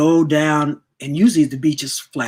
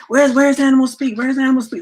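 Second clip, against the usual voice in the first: daughter calling; 10 semitones higher, 5 dB louder than usual. Retold or imitated. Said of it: "I heard my daughter".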